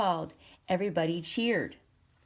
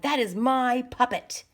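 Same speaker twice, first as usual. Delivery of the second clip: angry claim; 5 semitones higher, 5 dB louder than usual. A narrator's reading. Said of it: "he cried".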